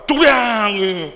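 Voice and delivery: loud, slow grumbling burst; mock angry outburst. Husband imitating wife's angry grumble, loud and drawn out. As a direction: drag it out like a loud angry grunt.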